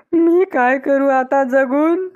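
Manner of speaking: slow, drawn-out acted lament; theatrical widow's grief, dragged out; despairing lament. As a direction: wail slowly in mock despair. Widow mourning her husband.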